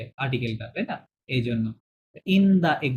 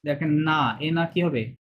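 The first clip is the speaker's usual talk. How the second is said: normal talking voice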